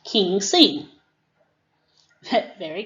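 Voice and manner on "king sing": loud, eager student reply; student answer. Shown as a demonstration. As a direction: answer brightly like a pupil. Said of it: "And the student says".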